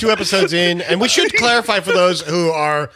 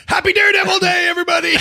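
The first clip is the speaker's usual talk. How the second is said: cheerful shout; faster than usual. Retold or imitated. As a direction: call it out to the whole office. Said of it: "leaves work yesterday going".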